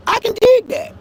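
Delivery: high loud mock approval on "dig"; mocking shout. Real, not acted. Mocking critics with a loud high yelp.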